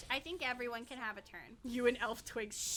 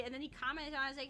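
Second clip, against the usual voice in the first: ordinary delivery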